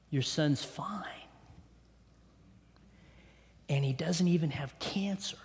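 hushed whisper of relief; soft awed whisper of a doctor's report; whispered good news. Retold or imitated. Doctor's news retold breathlessly.

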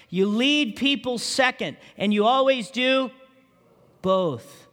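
rousing preacher, pushing each line upward; preaching. Acted. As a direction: press the congregation hard. Sermon climax, raised exhortation.

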